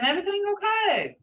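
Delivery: upbeat check-in; cheerful high question. Shown as a demonstration. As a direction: ask it brightly and briskly. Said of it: "Hear that tone?".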